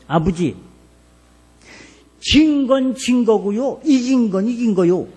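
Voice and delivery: mild imitation of a know-it-all son; child talking back. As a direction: lecture dad like a smart-aleck kid. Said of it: "내가 어린 소견에 말이요".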